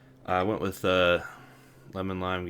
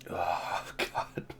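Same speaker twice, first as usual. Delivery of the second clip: breathy, voiceless "oh God"; disgusted groan; 5 dB quieter than usual. Real, not acted. Drinker recoiling from gin and Doctor Pepper, disgusted. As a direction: let the disgust out on a soft exhale. Reacts to bad drink's aftertaste.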